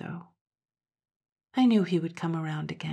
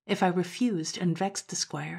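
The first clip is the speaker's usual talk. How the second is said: ordinary delivery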